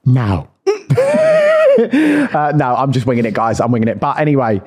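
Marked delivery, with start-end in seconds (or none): 0.6-1.7 squealing laugh; high burst of laughter. Real, not acted. Admitting she has no ski boots, with a high laugh.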